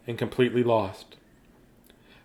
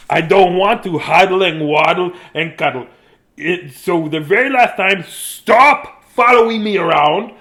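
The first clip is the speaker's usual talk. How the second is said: fed-up scolding; 5 semitones higher, 8 dB louder than usual. A narrator's reading. Dudley scolding siblings who follow him, loud and exasperated. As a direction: tell them off, louder as it goes.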